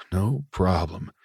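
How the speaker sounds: slow, soft, teasing echo of his words; man flirting back at the mechanic, slow and suggestive. Acted. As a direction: drawl it back to him like an innuendo. Repeats Walker's words suggestively.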